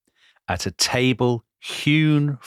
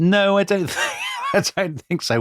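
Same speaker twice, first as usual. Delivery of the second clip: laughing no; 12 semitones higher than usual. Real, not acted. Laughing denial of a suggestion.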